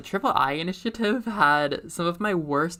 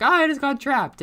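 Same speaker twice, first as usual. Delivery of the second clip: put-on high, loud kid voice, faking innocence; innocent kid voice; 11 semitones higher, 8 dB louder than usual. Kid lies to the pirate, loud and high. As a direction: squeak out a guilty kid's excuse. Retelling the kid's excuse.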